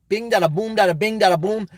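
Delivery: singsong droning rattle of syllables; emotionless delivery. Shown as a demonstration. Streamer showing how not to campaign, emotionless. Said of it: "you can't go up there and say".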